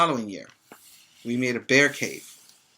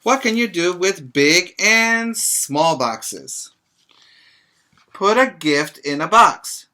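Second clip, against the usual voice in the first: bright, louder storybook read-aloud; reading to children; 5 semitones higher, 7 dB louder, slower than usual. Acted. Teacher reading a picture book to her pre-K class, lively read-aloud.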